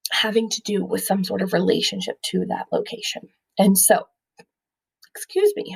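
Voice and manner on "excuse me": squeaky hiccup breaking in; words cut by a hiccup. Real, not acted. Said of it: "I have the hiccups".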